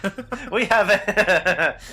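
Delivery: talking through giggles; laughing. Real, not acted. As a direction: laugh while getting the words out. Laughing mid-sentence.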